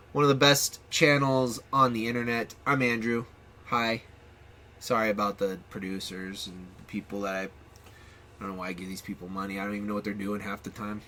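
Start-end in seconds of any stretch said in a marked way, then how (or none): none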